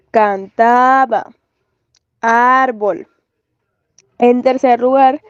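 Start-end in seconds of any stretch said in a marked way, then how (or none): 0.1-1.3 "cantaba" slowly, TA stretched and stressed; "cantaba" as a slow demo, weight on TA; stress on penultimate syllable. Shown as a demonstration. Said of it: "El acento está en la penúltima sílaba". 2.2-3.1 "árbol" with AR held and stressed; "árbol" as a careful demo, first syllable prominent; stress on penultimate syllable. Shown as a demonstration. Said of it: "El acento está en la penúltima sílaba".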